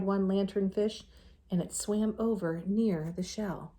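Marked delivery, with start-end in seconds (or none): none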